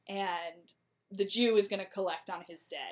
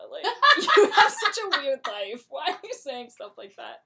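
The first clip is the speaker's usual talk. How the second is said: laughing loudly through the line; mock disbelief, cracking up; loud amused laugh while scolding; 5 semitones higher, 10 dB louder than usual. Retelling her reaction, laughing.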